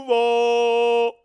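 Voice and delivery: very loud, high, drawn-out shout; hometown holler. Real, not acted. Speaker hyping Jacksonville to listeners, drawn-out holler.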